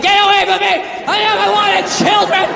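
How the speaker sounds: a hint of a harsh father brushing him off; cold father. Father rejecting the child, mildly voiced cold. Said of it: "He's like".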